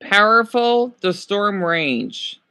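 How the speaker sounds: slow, drawn-out "Powerful, the storm raged"; dramatic reading. Shown as a demonstration. Vlogger testing odd word order aloud, slow dramatic read. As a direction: read it like a grand storyteller, slowly. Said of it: "I don't talk like that".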